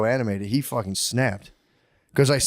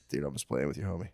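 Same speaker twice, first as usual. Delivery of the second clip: low, much softer retold aside; quiet backing off; 10 semitones lower, 11 dB quieter than usual. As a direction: defuse it under your breath. Retold backing off after they froze.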